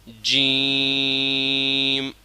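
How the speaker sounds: جیم stretched long and very slow; drawn-out jeem. Shown as a demonstration. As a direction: drag out جیم slowly. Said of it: "جیم کو ذرا کھینچ کے پڑھنا ہے".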